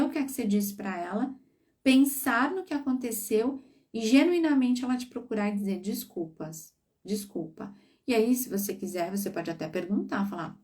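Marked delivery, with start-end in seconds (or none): 5.8-7.7 much softer, small sorry; soft apology. Pretend. Child comes back to apologize to mother, quietly.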